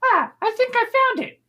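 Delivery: very high falsetto character voice; tiny high-pitched adventurer; Ford. Acted. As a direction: squeak it out in a small, high voice. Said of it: "you have Ford goes".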